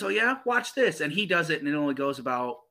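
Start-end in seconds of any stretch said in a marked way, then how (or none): 0.0-1.0 put-on higher-pitched boyish challenge; cocky brother. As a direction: imitate a brother's dare in a raised pitch. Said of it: "He goes".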